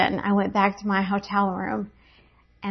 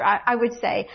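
usual speaking voice

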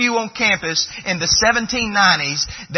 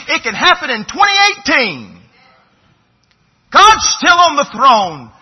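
loud, raised exhortation to the congregation; full-force revival preaching, louder and higher; fiery preaching; 6 semitones higher, 6 dB louder than usual. Acted. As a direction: press the church hard, rising to a shout.

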